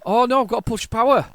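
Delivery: loud, high cry of dismay; real flustered outburst; alarmed realisation. Real, not acted. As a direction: blurt it out in sudden game panic. Sudden realisation mid-game.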